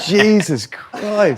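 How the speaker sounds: slow, laughing disgust. Real, not acted. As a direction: drag it out, half laughing. Reacting to a terrible smell.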